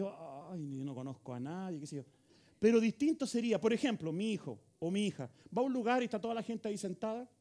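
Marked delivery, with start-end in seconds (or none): 0.0-2.0 small, low, softened voice; bashful, acting out social awkwardness; embarrassed newcomer. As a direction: shrink into a shy, self-conscious murmur. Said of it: "como que le da plancha".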